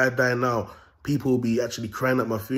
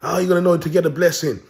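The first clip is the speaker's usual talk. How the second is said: higher, louder mock of online gurus; lightly mocking imitation, raised voice; mocking other video makers; 8 semitones higher, 6 dB louder than usual. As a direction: mimic preachy video makers, pitched up. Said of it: "put out videos and say".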